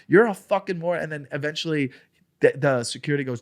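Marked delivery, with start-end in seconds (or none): 0.1-1.0 higher, louder retold insult; retold shouted insult. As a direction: fling the insult at the heckler. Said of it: "I go".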